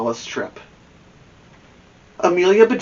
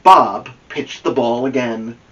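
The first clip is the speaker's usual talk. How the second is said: usual speaking voice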